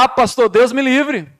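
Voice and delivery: high, loud mock horror; imitating a scandalized churchgoer; shocked. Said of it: "Você fala".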